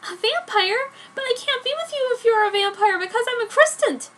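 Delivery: put-on high, bright girlish voice for the heroine; Joan's voice.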